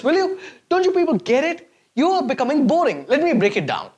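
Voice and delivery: normal talking voice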